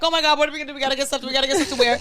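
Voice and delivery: imitating a frantic team; mock panic. Team panicking about outfits.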